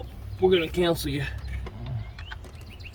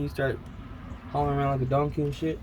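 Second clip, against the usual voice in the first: ordinary delivery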